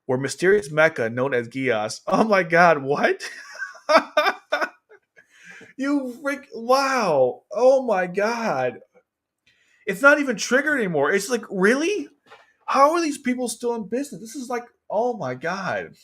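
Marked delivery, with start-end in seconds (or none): 2.1-3.2 higher, stunned reaction; incredulous outburst. Real, not acted. Baffled by the article. 3.3-4.7 high, helpless burst of laughter; incredulous laugh. Real, not acted. Laughs at the absurd article. 5.8-15.9 high-pitched incredulous rant; exasperated disbelief. Real, not acted. Exasperated at the article's mistakes.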